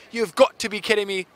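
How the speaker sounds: put-on a light, half-hearted South African commentator accent. Host imitating the South African commentator for viewers, light South African accent. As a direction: toss off a quick South African commentator line, lightly done. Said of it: "I don't know if that was a good accent".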